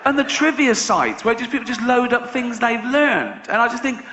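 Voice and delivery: ordinary delivery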